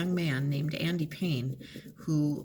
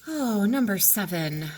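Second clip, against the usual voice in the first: ordinary talk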